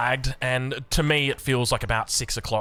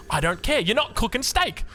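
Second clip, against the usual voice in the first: imitating mum refusing; 10 semitones higher than usual. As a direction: lay down the law like mum. Mum's final refusal.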